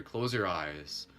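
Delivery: slow, soft guided cue; soft guidance. Acted. Instructor guiding the class to close their eyes softly. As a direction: ease them into stillness.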